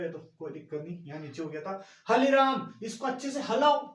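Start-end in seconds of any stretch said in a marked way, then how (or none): 2.1-3.8 loud, high call to the cook; shouted order. Acted. Teacher shouting at the cook to stir it well, loud. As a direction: bark the order across the kitchen.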